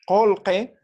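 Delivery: "kolke" with back k, open o and e, emphatic and loud; careful model pronunciation. Shown as a demonstration. Teacher showing the vowel change after Q, "kolke" pronounced clearly. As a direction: say "kolke" firmly as a model word. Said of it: "Pronunciamos de esta forma".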